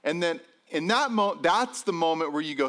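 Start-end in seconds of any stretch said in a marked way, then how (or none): none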